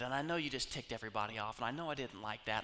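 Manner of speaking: ordinary talk, nothing marked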